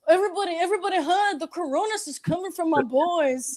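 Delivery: high-pitched mock grandma warning; hammed-up Texas grandma voice; grandma's Texas accent. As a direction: imitate grandma alerting everyone. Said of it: "She was like".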